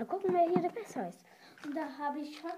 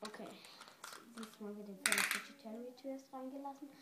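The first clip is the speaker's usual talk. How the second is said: ordinary delivery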